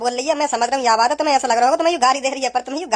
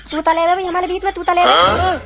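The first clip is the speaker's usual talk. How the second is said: higher, lisping childish voice, hammed-up; mock lisping baby talk; 5 semitones higher, 4 dB louder than usual. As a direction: put on a lisp like a small child. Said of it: "मो तुतना चाहिए". (तुतलाती आवाज़ में नकल)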